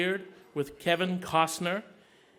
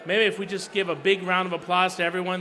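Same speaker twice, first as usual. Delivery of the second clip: normal talking voice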